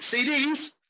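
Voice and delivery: high incredulous squeak; mock disbelief. Tourist scoffing at CD sellers, high mock disbelief. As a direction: pitch it way up in disbelief. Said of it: "It's like".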